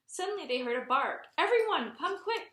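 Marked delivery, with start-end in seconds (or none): 1.4-2.5 high urgent call; calling excitedly. Acted. Arthur calling everyone to see the puppies, high and excited. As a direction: call the family over, thrilled. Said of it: "called Arthur".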